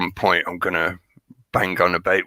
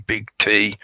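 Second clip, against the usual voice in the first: ordinary delivery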